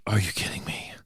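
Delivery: breathless incredulous whisper. Retold or imitated. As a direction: whisper it under your breath in disbelief.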